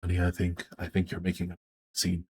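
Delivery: soft gentle husband. Acted. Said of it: "He's like".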